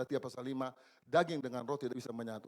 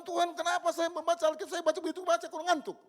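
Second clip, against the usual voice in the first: crying prayer; 8 dB louder than usual. Retold or imitated. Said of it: "Saya nangis. Saya bilang".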